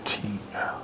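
whispered curse under the breath; acted dismayed aside, barely audible; muttered dread. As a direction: drop to a very soft whisper. Dread as the stranger returns.

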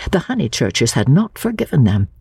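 ordinary delivery

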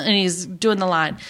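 usual speaking voice

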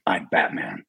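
low, narrow, short and direct; Batman voice. Acted. Actor drops into his low, punchy Batman voice. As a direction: growl it out as the caped hero, punchy. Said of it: "as Batman, it's pretty narrow... very direct".

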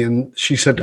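ordinary talk, nothing marked

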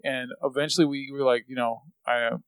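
ordinary talk, nothing marked

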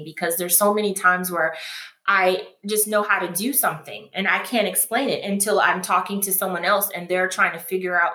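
ordinary talk

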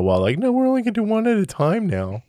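high, loud mocking voice of a studio; mock Amazon exec. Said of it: "Amazon decided to go".

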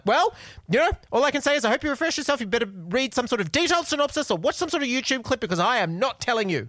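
stern warning. Retold or imitated. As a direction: scold her like a know-it-all. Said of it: "And he was like".

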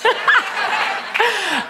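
very high, long cackle. Real, not acted. Speaker laughing at her own joke, high cackle. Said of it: "give it up for my cackle".

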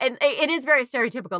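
usual speaking voice

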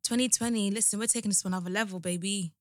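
soft, low-key delivery; understated, quiet hype talk; low-energy hype. Real, not acted. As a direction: promise big things with barely any energy. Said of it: "my voice and my face is not saying a lot".

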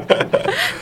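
squealing laugh; high squeal laugh. Real, not acted.

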